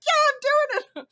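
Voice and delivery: high, loud, giddy excitement; giddy squeal. Retold or imitated. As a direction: squeal it out with delight. Giddy retelling of festival news.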